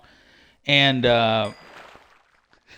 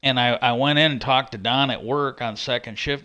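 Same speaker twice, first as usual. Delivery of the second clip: ordinary talk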